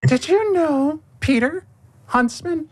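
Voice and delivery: put-on very high child voice; squeaky little girl asking. Little girl asks Peter.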